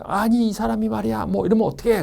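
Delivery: high-pitched mock customer complaint; angry customer. Customer fuming at a clerk in a store, mock angry. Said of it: "고객이 막 화를 냅니다".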